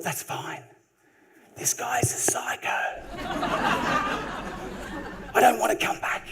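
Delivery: hushed inner thought. Retold or imitated. Private thought about the psycho.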